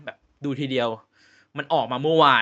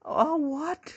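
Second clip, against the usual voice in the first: mock disbelief; 12 semitones higher, much slower than usual. Mocking reaction to spoiler plot twist.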